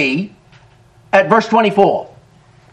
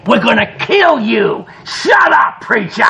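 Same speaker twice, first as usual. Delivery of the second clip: higher, imitated threatening yell; mock thug yell; 4 semitones higher than usual. Thugs yelling at the preacher, mock yell. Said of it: "they're yelling in my ear".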